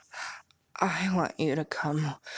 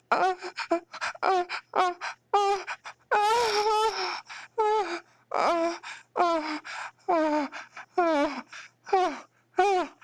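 climax cries; 9 semitones higher, slower than usual. Acted. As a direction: build the oh's up to a peak. Acted climax, crying out.

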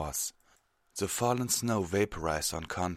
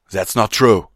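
loud, higher protest; shouted; 4 semitones higher, 15 dB louder than usual. Acted. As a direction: shout the denial. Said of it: "Terrado shouted".

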